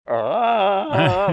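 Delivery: high, loud imitated baby squeal; baby's happy scream. Grandfather mimics granddaughter's squeal on stage, high loud squeal. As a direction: squeal like a delighted baby rocking out. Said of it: "who's smiling and laughing and screaming".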